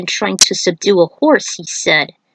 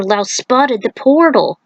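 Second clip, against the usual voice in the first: usual speaking voice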